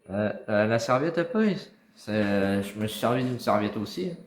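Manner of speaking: shouting to someone in another room; calling out. Real, not acted. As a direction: call it out loud across the house.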